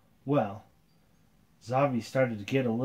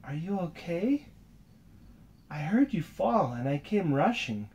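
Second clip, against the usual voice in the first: put-on gentle girl voice; 6 semitones higher than usual. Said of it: "and said".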